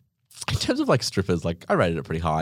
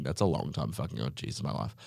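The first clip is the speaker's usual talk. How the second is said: whispered aside; 7 semitones lower, 9 dB quieter than usual. Real, not acted. Aside about her age.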